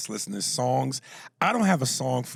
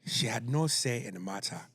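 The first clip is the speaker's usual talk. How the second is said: restrained African lawyer voice; African accent; 4 dB quieter than usual. Acted. African lawyer defending his client in court, African accent. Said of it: "doing a random African voice".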